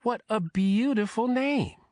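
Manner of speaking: high, slow, gushing compliment; delighted admiration. A narrator's reading. Grandmother complimenting her grandson's new girlfriend, delighted.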